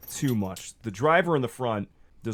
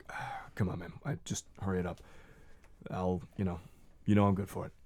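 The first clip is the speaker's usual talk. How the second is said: hushed trooper; 2 semitones lower, 7 dB quieter, faster than usual. Acted. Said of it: "Estrada looks at Eric and is like".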